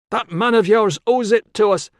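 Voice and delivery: high, loud accusing man; Con's accusation. A narrator's reading. Con blaming Tilla's man for the debt, high and loud. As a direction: jab the blame at her.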